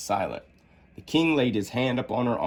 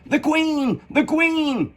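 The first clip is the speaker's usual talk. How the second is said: high alarmed call; much slower than usual. A narrator's reading. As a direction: cry out the warning high. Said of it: "Five called out".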